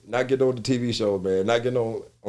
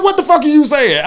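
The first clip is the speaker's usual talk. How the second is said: much higher, louder confused outburst; mock bewildered yell; 12 dB louder than usual. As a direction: Monique yells in confusion. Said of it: "She said".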